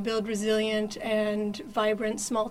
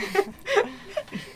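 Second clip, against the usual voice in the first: laugh; 11 semitones higher than usual. Real, not acted. Laughs after remark.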